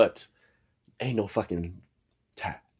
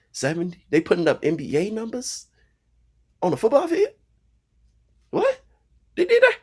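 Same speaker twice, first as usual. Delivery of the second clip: incredulous; 13 semitones higher, 6 dB louder than usual. Real, not acted. Disbelieving rant at blowout score.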